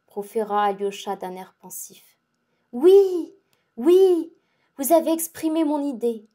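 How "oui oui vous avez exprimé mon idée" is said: bright, higher burst of yes, yes; eager agreement. Acted. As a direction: jump in eagerly, a touch louder.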